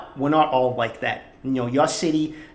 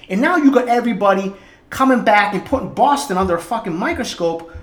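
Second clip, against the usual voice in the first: heated rant; 7 semitones higher than usual. Real, not acted. Ranting against straight pride parade.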